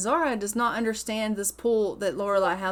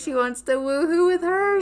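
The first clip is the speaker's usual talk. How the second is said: ordinary talk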